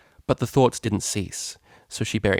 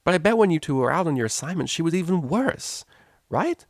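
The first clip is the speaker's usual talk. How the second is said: wheedling Lyra; 8 semitones higher than usual. A narrator's reading. Lyra pressing for agreement.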